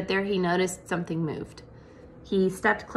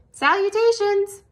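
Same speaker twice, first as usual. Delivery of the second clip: very high, louder little greeting; tiny spider voice; 12 semitones higher, 5 dB louder than usual. A narrator's reading. Charlotte's daughter greets Wilbur, very high. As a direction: chirp a polite hello. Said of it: "it said".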